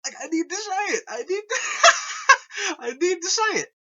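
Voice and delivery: high, laughing, voice cracking with excitement; half-laughing, half-crying through the words; laughing fit. Real, not acted. Fan overwhelmed by the game, laughing through it.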